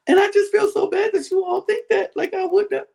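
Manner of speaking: sustained very high whine; tearful mock Linda. Housemate crying to her accusers, high mock-tearful whine. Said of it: "then she starts crying again".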